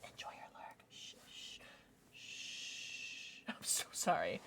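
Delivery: hushed whispered apology. Pretend. Streamer apologizing to chat for the lurk, whispered. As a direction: breathe it out like a secret.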